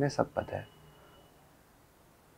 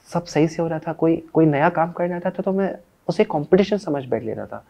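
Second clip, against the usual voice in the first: higher-pitched rush of elation; excited; 8 semitones higher, 4 dB louder than usual. Acted. Cook recalling when work finally clicked, excited. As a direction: tell it fired up, voice lifted.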